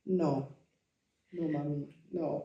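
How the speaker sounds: soft, deadpan refusal as her son; disapproving son. Retold or imitated. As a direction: shut mom down quietly, with pauses. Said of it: "He was like".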